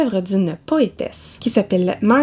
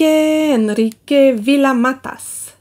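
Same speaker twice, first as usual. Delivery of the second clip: slow, drawn-out mock Spanish accent; hammed-up Spanish accent; 4 semitones higher, much slower than usual. Book vlogger showing off an author's name, mock Spanish accent. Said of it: "Et mon accent espagnol, c'est fabuleux".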